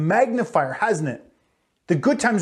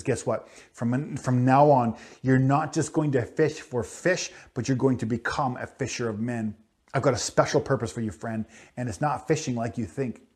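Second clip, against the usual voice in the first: Jesus speaking gently; 7 semitones lower, 5 dB quieter than usual. Retold or imitated. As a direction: tell him kindly, close and soft. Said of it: "And Jesus says".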